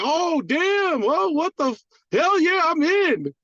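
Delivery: high, bursting with excitement; retold giddy yes, way up high; thrilled reaction. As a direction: squeal it like you can't believe your luck. Retelling his thrilled reaction.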